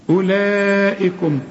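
أولئكم slow with the waw unsaid; tajweed reading; silent waw. Shown as a demonstration. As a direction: recite أولئكم slowly. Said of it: "شايف الواو".

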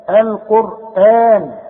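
القرآن with the alif after the hamza held for two counts; long madd. Shown as a demonstration. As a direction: stretch the aa in القرآن slowly and loudly. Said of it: "الهمزة في وسط السطر بعدها مد". (مد الألف في القرآن حركتين)

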